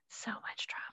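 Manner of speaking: dramatic stage whisper. Pretend. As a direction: hush it like gossip. Echoing the drama line.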